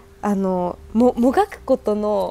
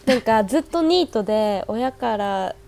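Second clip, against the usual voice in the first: normal talking voice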